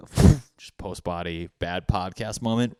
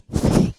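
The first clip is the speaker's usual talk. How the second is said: sudden loud burst of laughter; short laugh; 10 dB louder than usual. Real, not acted. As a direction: laugh out loud mid-thought. Laugh mid-sentence.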